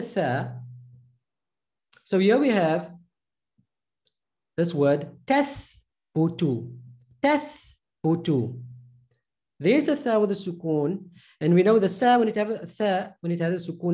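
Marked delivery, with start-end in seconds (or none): 5.2-8.6 tathbutu drawn out slowly; slow sounding out. Shown as a demonstration. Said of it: "How are we going to read".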